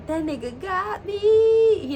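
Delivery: high, drawn-out confession; embarrassed mock wail. Real, not acted. Confessing the embarrassing part to viewers, mock wail. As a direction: stretch the words out high, playing up the embarrassment.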